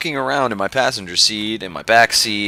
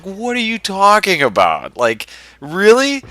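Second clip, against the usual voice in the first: re-enacted high, loud disbelief; incredulous; 11 semitones higher, 4 dB louder than usual. Re-enacts her disbelief at the accusation.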